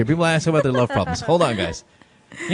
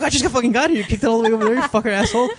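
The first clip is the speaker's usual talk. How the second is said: mock angry player; 6 semitones higher, faster than usual. Player griping at teammate.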